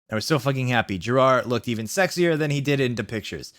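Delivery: ordinary talk, nothing marked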